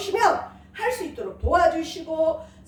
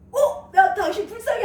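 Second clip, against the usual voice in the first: startled question; 7 semitones higher, 4 dB louder than usual. Retold or imitated. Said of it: "하려다가".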